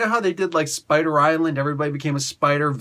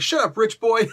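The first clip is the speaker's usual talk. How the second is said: a sneering put-down in a higher voice; mocking dismissal; 6 semitones higher than usual. Teammate shutting up Iron Fist, sneering. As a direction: brush off the rich kid.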